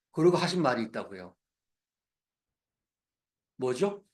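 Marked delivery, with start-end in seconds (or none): none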